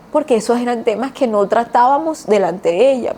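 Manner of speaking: ordinary talk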